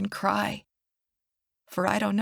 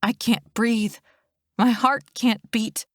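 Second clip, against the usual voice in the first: usual speaking voice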